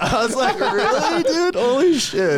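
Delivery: high, loud laughing disbelief; cracking up at the news; laughing it out in shock. Real, not acted. Laughing reaction to friend's account.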